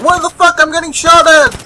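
high, loud mock panic; mock panicked enemy. Voicing a confused enemy player, high loud mock panic. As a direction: squeal it like a startled soldier. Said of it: "He's like".

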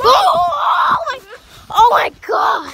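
loud alarmed cry; startled shout. Real, not acted. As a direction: yell in sudden fright. Nearly falling off trampoline.

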